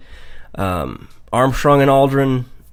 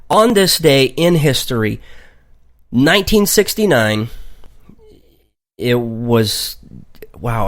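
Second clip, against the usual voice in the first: usual speaking voice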